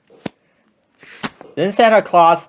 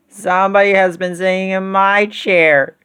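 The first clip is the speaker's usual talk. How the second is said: high-pitched tiny bear voice; wee little voice; 6 semitones higher than usual. A narrator's reading. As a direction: pipe up as an upset little cub. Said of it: "in his wee little voice".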